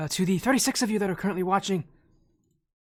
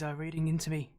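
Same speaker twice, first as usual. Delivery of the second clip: normal talking voice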